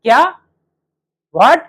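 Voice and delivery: sharp rising what; blunt what. Shown as a demonstration. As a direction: snap a rude what, as the wrong way. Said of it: "तो ये नहीं कहना". (गलत तरीका, तीखा "क्या? What?")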